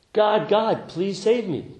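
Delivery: high, loud acted plea to God; desperate yell. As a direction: beg heaven at full voice, hammed-up. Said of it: "he yells out".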